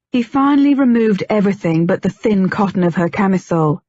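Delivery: plain reading voice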